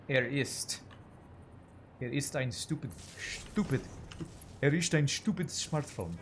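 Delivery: halting tries at a mock German accent; attempted German accent. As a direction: rehearse a hammy German accent, unsure of it. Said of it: "the German accent".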